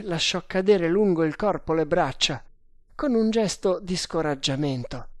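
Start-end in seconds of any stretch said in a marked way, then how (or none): none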